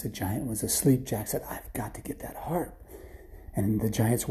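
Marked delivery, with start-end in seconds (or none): 1.5-2.8 soft, half-whispered plotting; hushed resolve. Acted. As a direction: whisper to yourself beside a sleeper. Jack plotting beside the sleeping giant.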